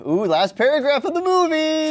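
high excited squeal. Real, not acted. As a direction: announce it with glee. Excited announcement.

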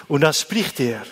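ordinary talk

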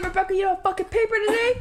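mock the exasperated teacher through a laugh; scolding teacher. Mimicking the Haitian teacher scolding Rebecca, high and laughing.

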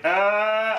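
high, sustained mock cry; exorcism cry. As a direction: wail as if casting out a demon. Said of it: "you're like".

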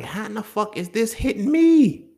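shocked high-pitched disbelief; baffled outburst. Retold or imitated. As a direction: squeal the question in disbelief. Imitating baffled saver.

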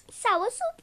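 high, whiny mock wail; fake-sob it, hammed up; mock crying. Wailing over sour candy.

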